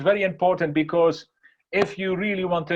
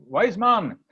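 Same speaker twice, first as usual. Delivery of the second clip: higher, acted call at a door; 4 semitones higher, 3 dB louder than usual. Boys at the wise man's door, calling out. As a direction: call for the old man to come out.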